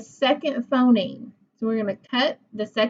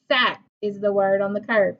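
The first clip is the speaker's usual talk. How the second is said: normal talking voice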